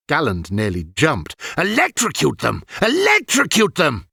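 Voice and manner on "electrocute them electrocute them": high, rising disbelieving outburst; outraged cry. A narrator's reading. Galland aghast at Ilian, high outraged cry. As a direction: cry it up to the sky. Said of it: "he cried to the sky".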